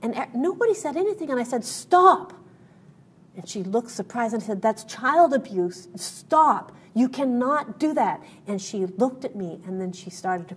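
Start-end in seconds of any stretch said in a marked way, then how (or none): 1.6-2.3 sharp shouted stop, much higher and louder. Retold or imitated. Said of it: "'cause I used my loud voice". 4.6-8.2 firm raised scolding, the stop far louder; slow, stern call-out of a mother in public. Retold or imitated. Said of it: "'cause I used my loud voice".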